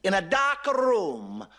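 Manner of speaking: slow, high, savoring each word; mock solemn papal pronouncement; Pope's slow put-down. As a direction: lay the insult down deliberately. Pope delivering his insult slowly.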